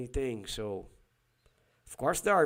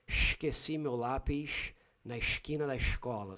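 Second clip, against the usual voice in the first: heavy "sh" for S in esquina and escola, Rio style; Carioca accent. Shown as a demonstration. Repeating the sentence as Cariocas say it, Rio accent. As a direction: say it like a Carioca, hushing the S. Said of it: "So they shh very much".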